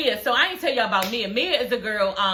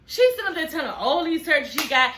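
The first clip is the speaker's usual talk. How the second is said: usual speaking voice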